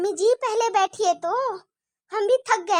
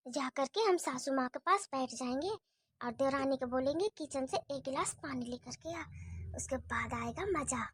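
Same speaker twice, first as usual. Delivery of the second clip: much softer, low sneaky muttering; conspiratorial aside; 6 semitones lower, 9 dB quieter than usual. Acted. Bahu plots to herself about the spilt oil, softer and lower. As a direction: plot under your breath. Plotting aside before mother-in-law.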